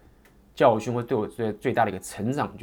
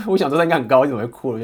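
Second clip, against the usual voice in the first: quick laughing recall; 5 semitones higher, 11 dB louder, faster than usual. Real, not acted. Laughs recalling crying friend.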